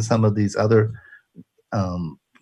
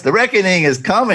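higher, louder booming sermon voice; preacher voice; 9 semitones higher, 6 dB louder than usual. Shown as a demonstration. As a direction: proclaim the reckoning from the pulpit. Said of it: "Should I say that with a preacher voice?".

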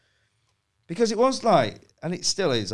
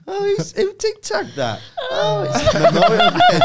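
panicked laughing; 9 dB louder than usual. Retold or imitated. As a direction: replay the panic while laughing. Mocks his own panic, laughing.

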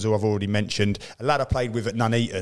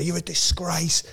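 lightly voiced mock telling-off, much higher; a hint of the scolding teammate, pitched up; imitating a teammate's scolding; 8 semitones higher, slower than usual. Teammate Gaz telling [name] he's a disgrace, mock high scold. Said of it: "He'd go".

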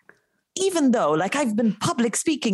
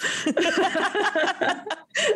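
bright laugh; 10 semitones higher than usual. Real, not acted. Laughing at host's joke.

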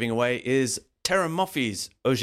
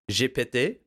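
usual speaking voice

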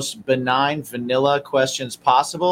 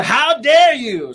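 mock outrage; 10 semitones higher, 6 dB louder, much slower than usual. Echoing the catchphrase.

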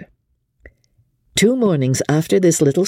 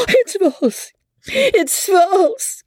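much higher, anguished cry of denial; Amelia crying out; much slower than usual. A narrator's reading. Amelia denying Becky's charge, anguished high cry. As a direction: refuse to believe it. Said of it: "cried out Amelia".

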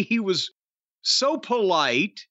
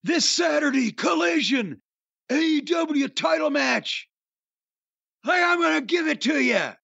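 hammed-up shout, pitched up; mock yell; 5 semitones higher than usual. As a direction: yell the challenge. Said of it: "he's screaming at him".

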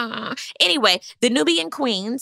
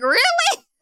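much higher, disbelieving question; incredulous high "Really?". Retold or imitated. To Candace about her pick, disbelieving. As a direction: sound baffled by the pick.

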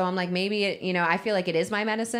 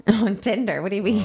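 surprised laugh; 4 semitones higher, 6 dB louder than usual. Real, not acted. Friend's wild rehab confession.

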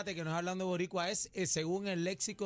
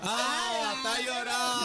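raised, louder jeer; loud taunting; 4 semitones higher, 5 dB louder, much slower than usual. Real, not acted. Contestant teasing a rival who complains about the clock, loud taunt. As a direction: jeer at a sore loser.

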